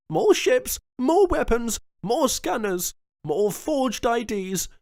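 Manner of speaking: high, loud, driving plea; rally him with passion; impassioned governor. A narrator's reading. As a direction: Jones arguing to stop the piracy, high and loud. Jones's long impassioned plea.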